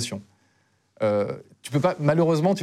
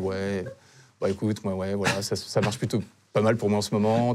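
low, softened seducer's voice; smooth charmer; 6 semitones lower, 3 dB quieter than usual. Acted. Man playing himself chatting up a date, low cool charmer. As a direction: play it cool, low and quiet. Joue le beau gosse en soirée.

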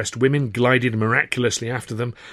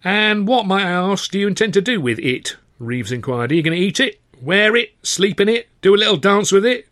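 high-pitched mocking interrogation; harassed manager, sarcastic; 9 semitones higher, 3 dB louder than usual. Manager grills young hopeful about his offer, sarcastic. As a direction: pile up absurd questions with mounting scorn.

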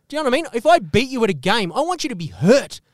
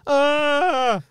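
higher, whiny mock complaint; mock whining; 5 semitones higher than usual. Mocking workers who cried during covid, in a higher whiny voice. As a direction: whine like a sorry-for-yourself worker. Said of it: "fucking crying".

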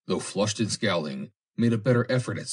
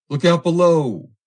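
loud, high warning shout; cheery call; 11 semitones higher, 12 dB louder than usual. A narrator's reading. Ross calling down the hatch, cheery shout. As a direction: sing out cheerily to those below. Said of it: "then called out cheerily".

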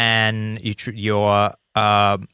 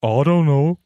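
higher, put-on cartoon giant; goofy giant's voice, pitched up; Simpsons giant voice; 5 semitones higher, 3 dB louder than usual. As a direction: play a dopey cartoon giant. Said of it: "classic sort of Simpsons giant voice".

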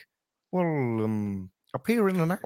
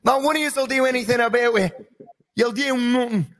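Conor McGregor; 14 semitones higher, 5 dB louder than usual. Retold or imitated. Comedian does his Conor McGregor impression, high-pitched Irish trash talk. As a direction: taunt them in a cocky Irish fighter voice. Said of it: "you get your Conor McGregor sometimes".

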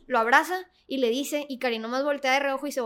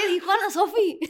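laughing; 5 semitones higher, 5 dB louder than usual. Retold or imitated. Laughing retold protest.

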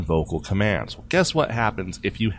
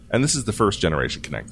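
ordinary talk, nothing marked

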